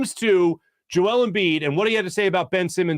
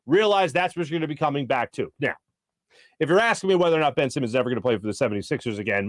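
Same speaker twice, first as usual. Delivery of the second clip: ordinary talk, nothing marked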